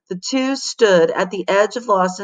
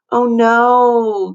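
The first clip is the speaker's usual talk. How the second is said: startled gasping cry; 3 semitones higher, 5 dB louder than usual. A narrator's reading. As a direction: catch your breath in alarm. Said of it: "gasped Missy".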